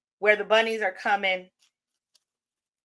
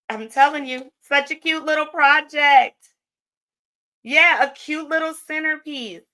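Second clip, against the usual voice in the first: gushing praise; 6 semitones higher, 5 dB louder than usual. Real, not acted. Closing praise of the project.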